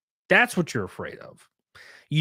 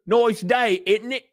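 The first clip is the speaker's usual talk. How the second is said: high-pitched mock British; hammed-up British voice, pitched well up; British accent; 8 semitones higher, much slower than usual. Shown as a demonstration. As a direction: put on a cartoonish British accent. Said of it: "Can you do a British accent?".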